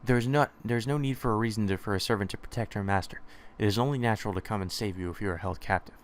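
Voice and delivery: ordinary delivery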